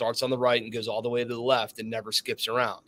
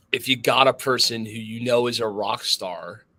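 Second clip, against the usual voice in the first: normal talking voice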